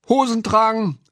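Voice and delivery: high, loud mocking call; two pranksters crying out high; gleeful shout. A narrator's reading. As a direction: shout it out as a merry taunt. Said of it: "riefen der Hosenträger und das Mädchen".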